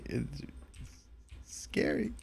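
breathy frightened whisper; barely voiced, scared. Real, not acted. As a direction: hush it like something might hear. Whispered in horror game.

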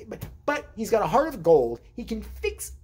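ordinary talk, nothing marked

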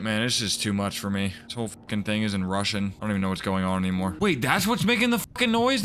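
usual speaking voice

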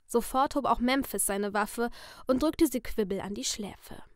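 plain reading voice

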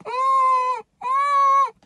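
high squeaky whimpering hum; scared kids' whimper. Retold or imitated. Kids in the back seat whimpering after mom's threat, high squeak. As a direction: squeak like a frightened kid. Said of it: "we'd be like".

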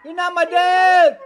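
slow emphatic yell; shouting. Shown as a demonstration. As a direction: call it out slowly. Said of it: "using our voice".

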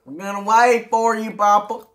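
loud, high put-on country kid voice. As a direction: play the farm kid greeting Papa. Same kid character.

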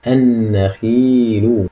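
النخيل very slow, long held vowel; drawn-out model reading. Shown as a demonstration. Teacher presents the third word, very slow drawn-out reading. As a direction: stretch النخيل out syllable by syllable. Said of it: "الكلمة التالتة".

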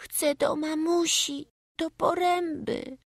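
put-on high, slow little boy voice, pleading; a homesick small boy, voiced high and slow; child's stammered whisper. A narrator's reading. Boy clinging to his foster mother, begging in a high slow voice. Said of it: "najcichszym szeptem wyjąkał".

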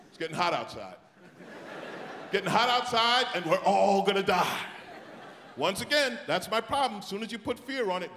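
normal talking voice

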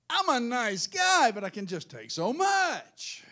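loud, high, drawn-out cry; loud comic exasperation; exasperated outburst. Real, not acted. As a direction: stretch it out at the end of your patience. Mock exasperated outburst at congregation.

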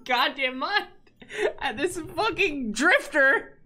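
high, wheezing laugh-talk through tears; laughing so hard it nearly turns to crying; helpless laughter. Real, not acted. Streamer losing it over a duck emote.

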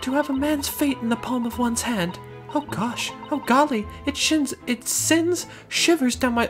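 high-pitched refined lady. Acted. Client swoons over lawyer.

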